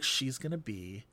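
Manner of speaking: slow, low, dropping to a whisper; mock devastated. Leaving the cinema pitying the character, mock-devastated hush. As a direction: mourn it half-jokingly. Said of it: "I was just like".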